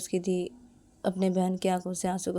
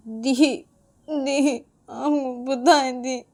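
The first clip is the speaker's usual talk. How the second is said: higher, halting crying voice; weepy, raised-pitch plea; tearful telling; 6 semitones higher, 5 dB louder, much slower than usual. Acted. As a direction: confide in big sister through acted tears. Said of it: "रोते रोते बोल देती है".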